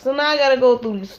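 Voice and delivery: ordinary talk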